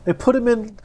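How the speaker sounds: ordinary talk, nothing marked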